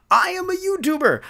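high-pitched protest; incredulous exclamation. Acted. YouTuber pointing out the absurd hypocrisy, incredulous high voice.

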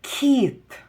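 "Кіт" said high and clearly; clear demo word. Shown as a demonstration. Teacher to pupils finding the first sound, "Кіт" said high and clearly. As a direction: pronounce "Кіт" sharply for children. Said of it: "Слухайте".

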